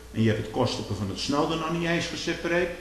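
normal talking voice

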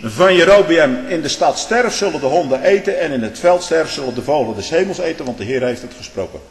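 loud solemn Bible reading; scripture reading. Acted. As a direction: read the prophecy out to the congregation.

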